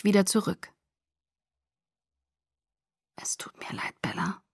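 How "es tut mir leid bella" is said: whispered murmur; barely audible murmur. A narrator's reading. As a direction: murmur it almost inaudibly. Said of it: "ein kaum hörbares Gemurmel". (kaum hörbar gemurmelt)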